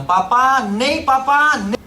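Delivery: loud, high-pitched cry to papa; child's yell. Retold or imitated. Sick boy refusing the doctor, imitated loud yell. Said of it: "Kairos teriak, Bapak Ibu".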